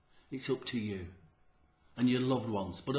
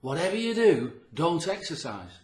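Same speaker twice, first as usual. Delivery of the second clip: mock warning; 5 semitones higher, 6 dB louder than usual. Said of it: "people saying".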